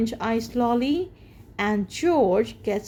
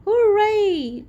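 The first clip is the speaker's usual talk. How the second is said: high, loud hooray; joyful children's cheer; 10 semitones higher, 6 dB louder than usual. Acted. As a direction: cheer with delight.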